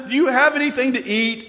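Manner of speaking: Jesus asks disciples for food, lightly voiced; voicing Jesus. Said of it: "he asked them".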